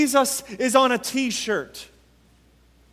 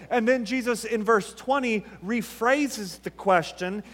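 normal talking voice

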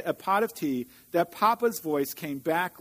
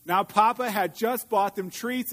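plain reading voice

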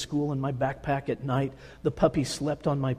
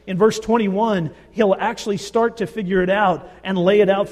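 higher and louder, driving the point home; full-force preaching; 3 semitones higher, 6 dB louder than usual. Acted. Preacher drives Paul's words home to the congregation, full force. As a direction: preach it out, building and pressing hard.

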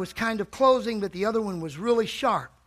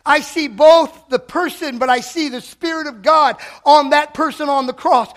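loud, high, shouting preacher; preaching at full force; 5 semitones higher, 9 dB louder than usual. Acted. Preacher shouting to the church about the cross. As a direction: cry it out over the church.

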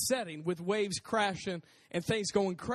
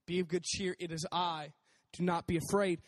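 ordinary talk

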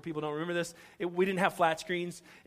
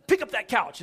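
loud, raised-pitch order; freaking out; 6 semitones higher, 9 dB louder than usual. Retold or imitated. Husband ordering his wife to lift furniture, freaking out. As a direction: shout an urgent command. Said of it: "I start freaking out".

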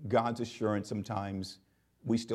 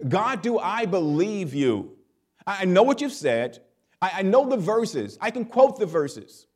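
higher and louder, pleading, climbing with each line; a believer wrestling with God, pushing higher each time; anguished prayer; 8 semitones higher, 4 dB louder than usual. Acted. Doubting believer praying to God, higher and louder, climbing.

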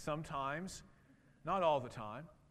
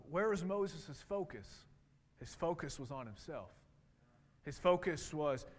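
normal talking voice

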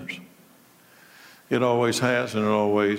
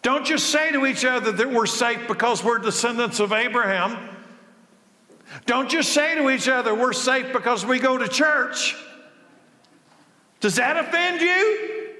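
full-force preaching; 13 semitones higher than usual. Acted. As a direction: hammer the question home to the crowd. Preaching climax continues.

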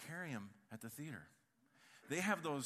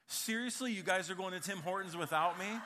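indignant brother; 4 semitones higher, 7 dB louder than usual. Retold or imitated. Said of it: "Ethan went".

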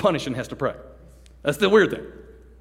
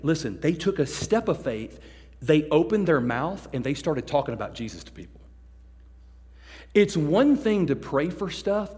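usual speaking voice

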